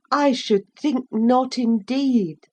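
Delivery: a hint of a brisk mother; firm mother. A narrator's reading. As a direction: settle the matter kindly. Mother reassures.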